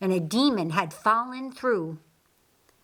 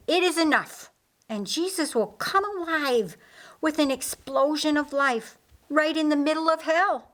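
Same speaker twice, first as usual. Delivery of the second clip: rising triumph; 5 semitones higher than usual. Acted. Triumphant prophecy of resurrection.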